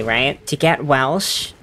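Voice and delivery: ordinary delivery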